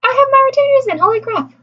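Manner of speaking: high, startled outburst; shocked. Real, not acted. Shocked noticing old video detail.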